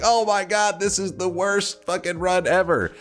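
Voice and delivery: high-pitched wailing imitation; mock crying complaint. Teller imitating girls at the finish, in a mock tearful wail. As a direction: imitate runners' tearful griping. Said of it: "they're, like, crying and shit".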